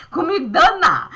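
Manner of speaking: laughing through the words. Real, not acted. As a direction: break into a laugh mid-line.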